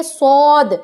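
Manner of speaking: صاد thick and heavy with a hiss. Shown as a demonstration. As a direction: fill صاد out, heavy and whistling. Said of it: "آواز کو آپ کو بھاری کر لینا ہے". (بھاری اور موٹی آواز میں صاد)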